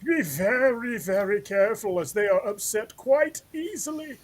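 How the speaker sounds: high-pitched hammed-up professor; put-on museum lecturer, swooping far up in pitch. Shown as a demonstration. Co-host auditions as natural history museum guide, hammed-up high professor voice. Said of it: "need the professor, I'll, like".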